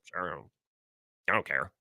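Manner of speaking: quiet shrugging don't-care; indifferent. Retold or imitated. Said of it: "Packers are like".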